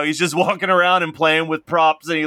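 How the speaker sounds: usual speaking voice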